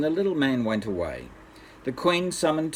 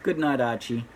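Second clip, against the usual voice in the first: ordinary delivery, nothing marked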